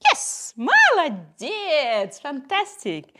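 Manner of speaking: high, slow gushing praise for a dog; over the moon for the pup; excited praise. Real, not acted. Praising dog after success.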